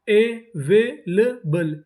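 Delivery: Available very slow as अ, वे, ले, बल; syllable by syllable. Shown as a demonstration. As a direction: sound out available with gaps between syllables. Teacher sounding out new word.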